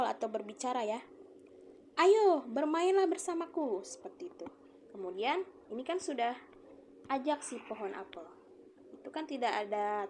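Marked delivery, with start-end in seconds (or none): 1.9-3.9 high, loud, slow invitation; a cheerful tree calling a boy to play; lively quoted speech. Shown as a demonstration. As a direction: call your friend over to play. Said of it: "seperti yang mengajak ngobrol".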